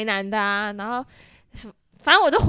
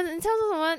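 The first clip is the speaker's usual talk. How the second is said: ordinary talk, nothing marked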